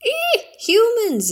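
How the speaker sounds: very high, startled bird screech. Acted. A startled bird spotting mermaids, high screeching cry. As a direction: shriek like a frightened seabird. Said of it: "it screeched".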